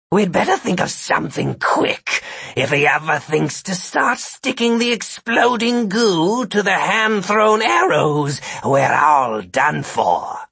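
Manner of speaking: snarling, drooling, dismissive; sarcastic snarl. A narrator's reading. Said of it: "sound sarcastic and dismissive while snorting and drooling".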